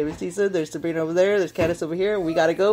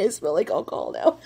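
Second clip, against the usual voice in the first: ordinary talk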